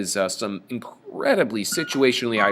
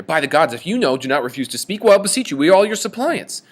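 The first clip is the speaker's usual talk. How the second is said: loud, higher pleading demand; angry pleading; 5 semitones higher, 6 dB louder, faster than usual. Retold or imitated. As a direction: beg him angrily, voice climbing high. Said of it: "he gets mad really quickly here".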